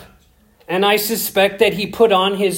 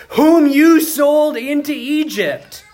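emotional cry; 10 semitones higher, 5 dB louder than usual. Acted. Joseph reveals himself emotionally.

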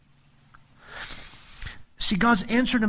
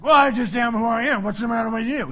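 louder, higher, mocking retort; snide, impatient brush-off; sarcastic God; 4 semitones higher, 5 dB louder, faster than usual. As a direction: toss it off sarcastically, like an annoyed god. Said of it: "like, God being sarcastic".

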